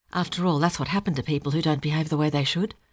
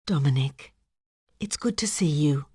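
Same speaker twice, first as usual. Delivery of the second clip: soft, whispered greeting; hushed and gentle; 2 semitones lower than usual. A narrator's reading. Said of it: "said a soft voice".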